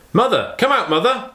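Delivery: father hollering for his wife from outside, pitched high; calling shout. A narrator's reading. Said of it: "he shouted".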